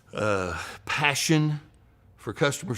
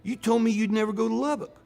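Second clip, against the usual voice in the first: higher, half-joking outburst at Hickman; mock exasperation; 10 semitones higher than usual. Friend throwing a napkin across lunch, mock exasperated. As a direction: toss it off like a playful scolding.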